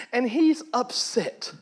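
normal talking voice

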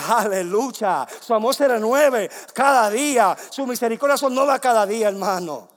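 loud, driving sermon delivery; full-force preaching. Real, not acted. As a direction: preach it hard, phrase upon phrase.

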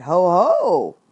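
as fierce as he can manage; loud, swooping attempt at a fierce call; Piglet. A narrator's reading. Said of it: "as fiercely as he could".

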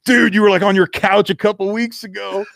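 high, loud ribbing; teasing teammate. Retold or imitated. Tony Gonzalez teasing him in the huddle, loud mock ribbing.

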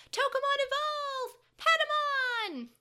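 high, loud, slow heroic call; evolution cry. Acted. Creature evolving into Patamon, high and loud. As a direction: shout the transformation.

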